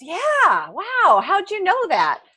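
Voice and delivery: high, impressed exclamation; mock amazed. Man on the ground amazed the balloonist guessed right, high and impressed. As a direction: act out being impressed. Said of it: "the guy goes".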